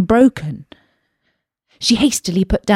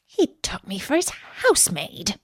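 softer, murmured aside; to herself; 8 dB quieter than usual. A narrator's reading. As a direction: say it quietly to yourself. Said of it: "she said to herself as she ran".

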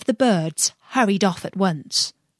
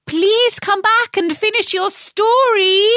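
high, loud call after someone; 14 semitones higher, 5 dB louder than usual. A narrator's reading. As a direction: call out so it hears you. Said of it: "Alice called after it".

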